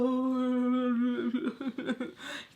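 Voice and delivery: long, high mock cackle; drawn-out put-on laugh, mimicking him; imitated laughing. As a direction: hold a high note that breaks into laughter. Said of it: "Earring back is laughing like".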